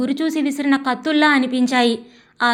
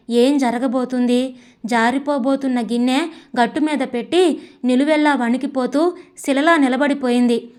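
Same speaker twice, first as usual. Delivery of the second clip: plain reading voice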